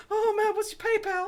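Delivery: high fawning imitation; simpering mock voice. Telling a friend about a fawning stranger, high fawning voice. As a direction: beg like a fawning fan, high. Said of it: "he was like".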